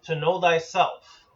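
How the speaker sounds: usual speaking voice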